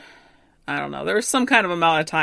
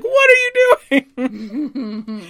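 higher, slow, drawn-out mock indignation; hammed-up outrage; 6 semitones higher, 3 dB louder, much slower than usual. Hero catching Ando with the bride, hammed-up outrage. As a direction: stretch it out in mock shock. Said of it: "He's like".

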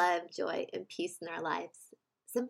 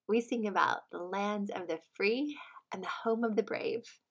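ordinary talk, nothing marked

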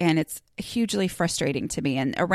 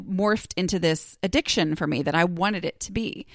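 normal talking voice

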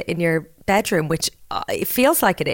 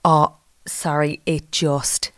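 ordinary talk

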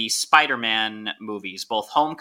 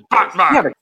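higher, louder masked villain voice; Bane voice; 7 semitones higher, 7 dB louder than usual. Pretend. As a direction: say it again in Bane's voice, laughing. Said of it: "That is an excellent voice".

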